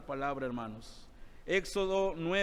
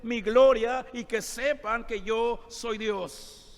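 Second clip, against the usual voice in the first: higher, louder, driving sermon delivery; preaching at full force; 6 semitones higher, 5 dB louder than usual. Acted. Pastor pressing the point to his brothers, forceful preaching. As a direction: preach it with mounting force to the hall.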